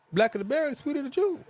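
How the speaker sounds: high put-on ending; mock saying. Mangling the proverb, high mock tone. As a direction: toss the line up high.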